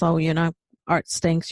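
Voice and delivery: normal talking voice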